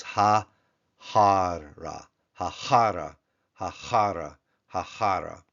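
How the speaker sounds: Ha-hara, stress on HA of hara; Ha-hara said syllable by syllable; teacher modelling Ha-hara; Hebrew Ha-hara. Shown as a demonstration. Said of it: "definite as well as directional".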